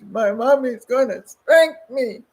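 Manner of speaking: put-on boy complaining to the stranger about his mother, slow child whine; child tattling. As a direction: tell on mommy like a small kid. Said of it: "he says".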